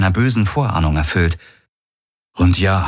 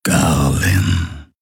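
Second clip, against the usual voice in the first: deep, drawn-out growling call; low humming voice from far away; deep rumble; 3 semitones lower than usual. A narrator's reading. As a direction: rumble the name slowly. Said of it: "brummte erneut eine tiefe Stimme".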